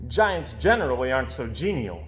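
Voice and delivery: plain reading voice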